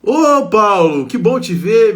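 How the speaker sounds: calling out loud and high, the name drawn out; glad loud greeting. Real, not acted. Greeting Paulo, who showed up, with a loud happy call.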